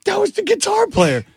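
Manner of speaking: high, amazed disbelief; stunned realization. Retold or imitated. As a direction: gasp it out in sudden realization. Realizing who [name] was.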